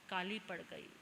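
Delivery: usual speaking voice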